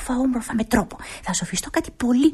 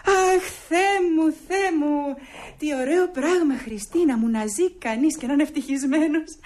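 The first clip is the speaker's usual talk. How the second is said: gushing joy; 6 semitones higher than usual. Acted. Nora gushing about her happiness.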